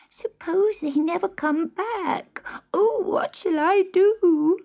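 high, worried kitten wail; tearful kitten. A narrator's reading. Kitten left all alone by his mother, high tearful worry. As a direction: fret in a tiny high voice. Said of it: "he stopped crying".

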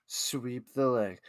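slow, low and soft; low menacing command. Acted. Commentator playing a coach to Johnny, low quiet command. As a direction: drop it down to a quiet, slow order.

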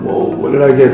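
ordinary talk

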